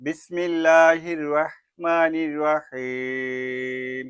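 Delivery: slow Quran-style recitation before a Bangla lesson; Quranic recitation. Acted. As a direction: intone it slowly and reverently. Recited opening formula.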